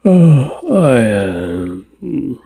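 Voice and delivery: broken sobbing cries; choked sobs; crying. Acted. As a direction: cry out in grief, voice cracking. Grieving outburst, crying heard.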